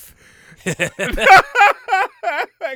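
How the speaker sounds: loud high-pitched burst of laughter; a sudden shrill-free howl of a laugh; new odd laugh. Real, not acted. Friend reacting with a big high laugh. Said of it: "Oh, that was a new laugh".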